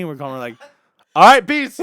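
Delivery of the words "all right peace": high, shouted brush-off; abrupt loud sign-off. Retold or imitated. Guys bail when the girls ask for money, loud brush-off. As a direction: blurt a quick loud goodbye and bail.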